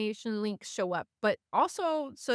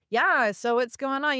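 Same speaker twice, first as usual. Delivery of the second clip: mock come-on; 4 semitones higher, 6 dB louder than usual. Imitating someone hitting on her.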